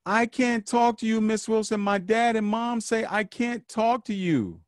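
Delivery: put-on child shouting from a car window; kid yelling out window. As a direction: shout it like a sad little kid leaving. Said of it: "the kid yells out the window".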